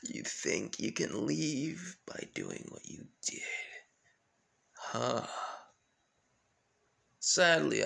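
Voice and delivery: quiet menace, fading to near whisper; menacing villain. Acted. Villain taunts and threatens.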